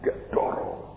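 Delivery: ordinary talk, nothing marked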